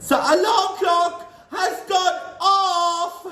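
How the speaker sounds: high, loud, slow shriek with a laugh; gleeful witch shriek. A narrator's reading. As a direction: shriek in triumph. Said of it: "shrieked the Grand High Witch".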